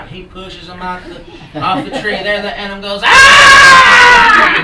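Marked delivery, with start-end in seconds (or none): 3.0-4.6 long, high, loud comic scream; falling scream. Acted. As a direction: scream as if shoved off a tree. Animal pushed off the tree.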